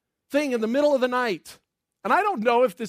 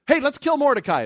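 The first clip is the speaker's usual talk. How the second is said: louder, higher, gleeful pitch of a plan; eager Haman; 5 semitones higher, 6 dB louder than usual. Acted. Haman striding into the court to propose his plan, eagerly. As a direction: burst in with the big idea. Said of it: "What's he coming in to say?".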